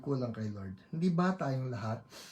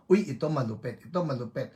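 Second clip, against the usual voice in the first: ordinary talk, nothing marked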